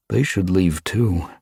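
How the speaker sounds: plain reading voice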